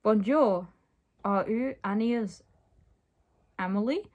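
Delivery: lightly attempted French accent; amateur French accent, halting between phrases. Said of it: "tried to make him French".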